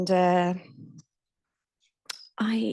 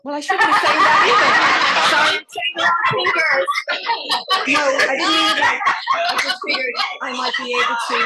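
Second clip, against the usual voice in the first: laughing, louder and higher, apologising through it; embarrassed laughing; 6 semitones higher, 8 dB louder than usual. Real, not acted. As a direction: giggle your way through the slip. Laughs at her own slip.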